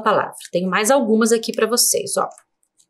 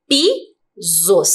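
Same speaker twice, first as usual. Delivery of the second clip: "Pisos" split as PI, pause, SOS; syllable by syllable; 2 semitones lower than usual. Shown as a demonstration. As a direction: break "Pisos" into two separate syllables. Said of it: "Duas sílabas".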